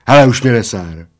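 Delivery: annoyed loud exclamation; exclaiming. A narrator's reading. Bald man fed up with his friend, exclaiming. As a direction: call it out, fed up. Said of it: "zvolal Plešatec".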